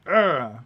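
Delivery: loud effortful "urgh"; biting grunt. Acted. Driver biting on a silver coin, loud grunt. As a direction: grunt while testing a coin with the teeth.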